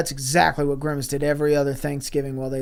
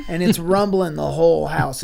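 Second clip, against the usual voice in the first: ordinary talk, nothing marked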